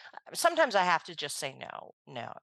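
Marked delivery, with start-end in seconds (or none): 1.5-2.3 simple, low and quiet no; a short, soft, matter-of-fact refusal; straight-out no. Acted. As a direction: refuse plainly, dropping the voice. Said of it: "straight out, simple, whatever".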